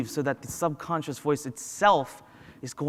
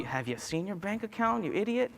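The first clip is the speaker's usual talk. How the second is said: softer, rising scornful doubt; mocking inner critic; 4 semitones higher, 6 dB quieter than usual. Inner voice mocking your bank account, scornful and softer. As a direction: needle them quietly, pitch climbing.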